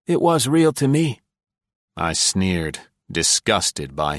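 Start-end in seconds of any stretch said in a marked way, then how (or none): 0.1-1.2 high, wistful question; old spy longing for the village; wistful Densham. A narrator's reading. Densham plaintive.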